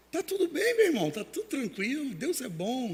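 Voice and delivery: high-pitched, breezy all-is-well act; smiling reassurance. Retold or imitated. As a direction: imitate a smiling pastor insisting everything is fine. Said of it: "aquele sorriso".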